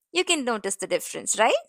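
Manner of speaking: ordinary delivery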